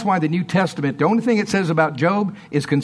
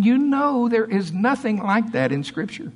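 ordinary delivery

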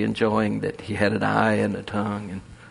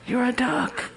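"You're a duck" jumped much higher with a rising "duck"; high insistence. Acted. The duck presses the chicken again in a much higher voice.

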